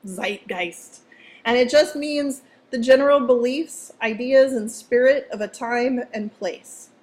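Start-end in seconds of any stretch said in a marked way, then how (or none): 0.1-1.0 softer goofy German accent; doofy German accent. Shown as a demonstration. Teacher repeating the word for students, doofy German accent. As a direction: say it quietly with a silly German accent. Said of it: "a doofy German accent".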